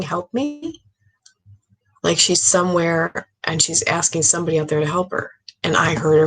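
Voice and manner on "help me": high, softened mock scream; imitated scream. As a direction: imitate a distant cry for help, high and quiet. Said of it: "that voice I heard yesterday screaming".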